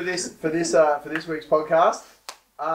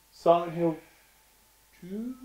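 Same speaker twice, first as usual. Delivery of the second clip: ordinary delivery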